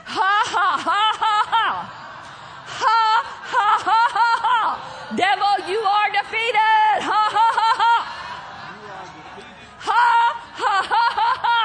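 high, loud, deliberate victory laughing; practised laugh of faith. Shown as a demonstration. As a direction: lead the room in triumphant ha-ha-ha bursts. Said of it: "Can you practice with me?".

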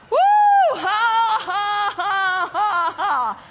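high whoop falling into ha-ha's; whoop, then force the laughter out; demonstrated laugh. Shown as a demonstration. Preacher models laughter for congregation, whooping fake laugh. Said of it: "Faking a laugh like I just did".

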